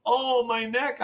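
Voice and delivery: high, drawn-out fake moan; mock a man putting on neck pain; feigned injury. Driver faking an injury after the bump, high mock moan. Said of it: "Oh, I'm gonna, oh, I'm, I'm hurt".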